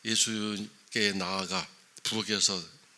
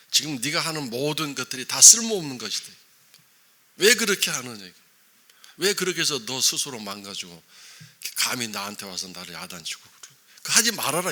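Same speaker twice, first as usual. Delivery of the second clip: loud, higher stern rebuke; Jesus rebuking Martha; 4 semitones higher, 10 dB louder than usual. Retold or imitated. Jesus rebukes Martha for her useless busyness, loud stern rebuke. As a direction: cut her pride down hard. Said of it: "마르다에게 완전히 김 빠지게 만드는 거죠".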